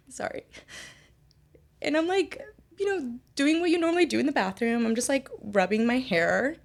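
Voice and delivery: high, tearful voice after a sigh; choked up. Real, not acted. Apologizing mid-story about losing hair, choked up. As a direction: push on through welling tears.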